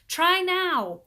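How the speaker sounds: high loud urge; urging. A narrator's reading. Paul urges Leslie, high and loud. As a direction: push her to try.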